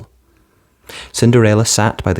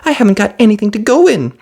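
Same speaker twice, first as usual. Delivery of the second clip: Cinderella's voice; 12 semitones higher, 4 dB louder, faster than usual. A narrator's reading. Cinderella answering fairy.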